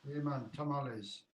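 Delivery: put-on light Mexican accent on "tamales"; a hint of a Mexican accent, softly; mock Mexican accent. Co-host playfully saying tamales in a mock Mexican accent. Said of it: "going through each country doing the accent".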